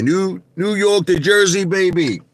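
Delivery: high, excited holler; hometown shout-out. Real, not acted. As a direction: call it out proudly to the crowd.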